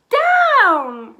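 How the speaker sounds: high, loud call; cried. A narrator's reading. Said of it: "cried the witch".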